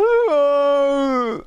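hammed-up ooh of despair; mock groan. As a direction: groan like a sore loser. Mimics a loser's groan.

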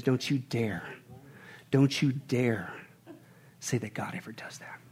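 low, hushed and slow, sinking toward a whisper; grave warning. Retold or imitated. Preacher quoting James's serious charge to the congregation, gravely hushed. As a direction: quote it as a solemn, very soft warning. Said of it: "It's a serious charge".

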